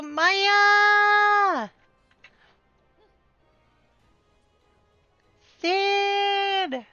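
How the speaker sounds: high bright name call; excited greeting. Real, not acted. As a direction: call out each name gladly.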